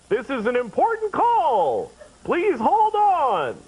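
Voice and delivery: slow, high, stretched-out announcement; automated hold message. Acted. Recording that calls you at home, slow sing-out hold announcement. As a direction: intone it like a recorded phone message. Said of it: "that call today where Hold calls you".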